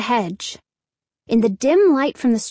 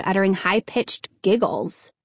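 ordinary delivery, nothing marked

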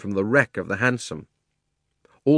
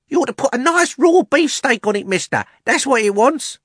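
high, loud, cocky lad's advice; cheeky butcher's boy; 7 dB louder than usual. Acted. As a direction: shout a tip across the crowd.

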